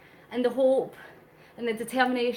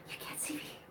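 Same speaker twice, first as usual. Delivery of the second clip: breathy mock-secret whisper, much softer; playful whisper; 18 dB quieter than usual. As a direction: whisper it like you're hiding from the camera. Playful hiding from viewers.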